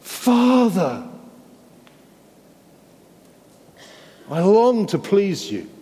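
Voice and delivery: a son begging his father in Gethsemane; Jesus crying out in anguish. Acted. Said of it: "he cried out".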